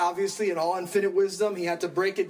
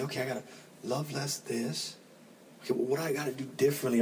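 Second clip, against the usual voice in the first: hushed inner voice; 5 semitones lower, 6 dB quieter, faster than usual. Retold or imitated. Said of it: "You say".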